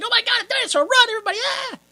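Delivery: high-pitched hammed-up alarm; imitated hysterical runner; mock panic. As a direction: squeal out a fake warning, trailing into a yelp. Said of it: "He's like".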